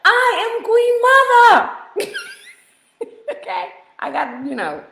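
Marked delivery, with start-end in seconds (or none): 0.1-2.2 high, loud and laughing, stretched out; mock regal shout. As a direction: proclaim yourself queen mother, hammed-up. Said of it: "She called me Nana".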